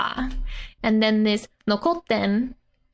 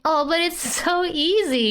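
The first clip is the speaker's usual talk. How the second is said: put-on playful girl voice; 7 semitones higher than usual. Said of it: "she's saying".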